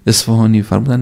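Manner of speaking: ordinary delivery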